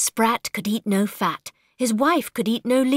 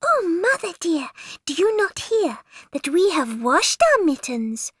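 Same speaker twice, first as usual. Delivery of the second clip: kitten voice; 6 semitones higher than usual. Acted. Kittens report to mother.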